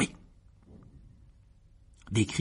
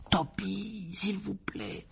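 higher, soft desperate plea; boy pleading; 10 semitones higher, 5 dB quieter, much slower than usual. Acted. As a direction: quietly beg him to stop. Harry pleads with Dobby.

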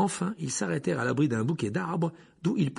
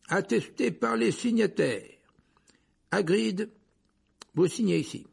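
high-pitched official reading aloud; Fudge; 8 semitones higher, 3 dB louder than usual. A narrator's reading. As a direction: read it out formally, high and carrying. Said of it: "pendant que Fudge continuait". (ton officiel, voix haut perchée)

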